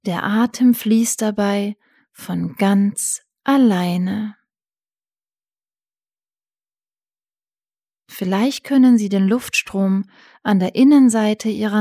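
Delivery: calm guided breathing; soothing spa whisper. Acted.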